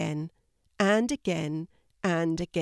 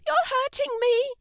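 squeaky, very high fish complaint; spluttering fish. A narrator's reading. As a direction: squeal it as a squeezed little fish. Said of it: "spluttered the fish in Kelty's hand".

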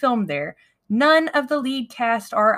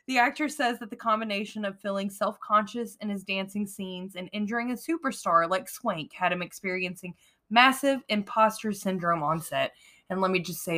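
usual speaking voice

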